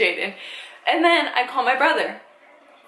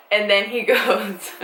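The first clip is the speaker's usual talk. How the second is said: ordinary delivery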